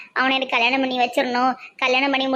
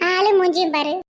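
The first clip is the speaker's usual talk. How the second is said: ordinary talk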